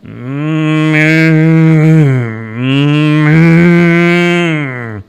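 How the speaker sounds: long swelling moo, twice; cow moo. A narrator's reading. Cow joining in with the singer, mooing. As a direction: moo along loudly to the song. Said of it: "who would moo along at times".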